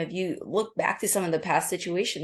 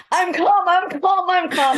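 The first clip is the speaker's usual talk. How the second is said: high, loud, strained "I'm calm" that is anything but calm; wrong way, shrill tense; 13 semitones higher, 9 dB louder than usual. Shown as a demonstration. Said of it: "use your calming voice".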